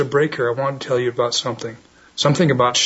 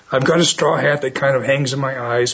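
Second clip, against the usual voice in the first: ordinary talk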